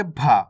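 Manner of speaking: भा pushed out with a puff of air; forceful aspiration. Shown as a demonstration. Teacher contrasts two letters for learners, भा strongly aspirated. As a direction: put force behind the B of भा. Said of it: "जो लागे".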